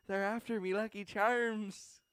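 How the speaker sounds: high, slow, loud cartoon leprechaun Irish; exaggerated Irish leprechaun, high and slow; leprechaun Irish accent. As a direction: belt it like the cereal ad leprechaun. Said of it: "Lucky Charms leprechaun".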